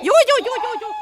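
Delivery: loud, laughing "joo joo"; playful laughing yeses; laughing reassurance. Acted. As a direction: laugh while soothing the patient. Laughing soothing.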